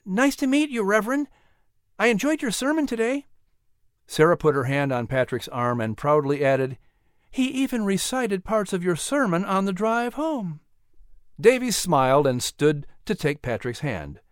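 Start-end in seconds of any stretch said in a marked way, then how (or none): 0.0-3.3 put-on higher boy's voice, polite and eager; a polite boy greeting the minister; young Patrick. A narrator's reading. 7.3-10.6 lightly voiced mother, higher and gentle; a proud mother calling her son in; Sarah. A narrator's reading.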